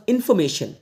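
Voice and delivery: इन्फॉर्मेशन without R, "in-fo-me-shan"; silent R. Shown as a demonstration. Teacher modelling native speakers' इन्फॉर्मेशन, R left silent. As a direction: leave out the R in इन्फॉर्मेशन. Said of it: "native speakers रा को pronounce नहीं करते".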